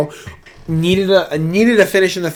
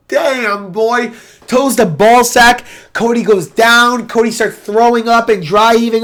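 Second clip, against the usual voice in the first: higher, slow incredulous recounting; excited disbelief; 6 semitones higher, slower than usual. Real, not acted. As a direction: tell it in shock. Excited recap of foul.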